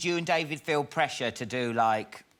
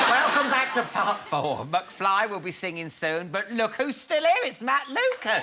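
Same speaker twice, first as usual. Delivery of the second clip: announcer; 7 semitones higher, 3 dB louder than usual. Acted. Host's show intro.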